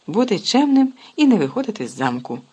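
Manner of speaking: plain reading voice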